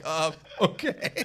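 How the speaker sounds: slow, hesitant acceptance; confused. Retold or imitated. Caller reacts to teammate's blunt statement, slow and confused. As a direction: imitate a baffled, drawn-out okay. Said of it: "so confused".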